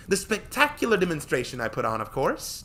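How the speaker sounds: put-on high, loud boastful girl; proud. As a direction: brag about the show. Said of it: "Tori said proudly".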